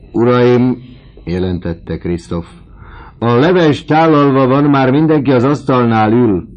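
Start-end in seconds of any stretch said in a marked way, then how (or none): 0.1-0.8 calling out. Acted. Servant announcing dinner. 3.2-6.5 high, loud call to the diners; announcing loudly. Acted. Servant calls the lodgers loudly to dinner.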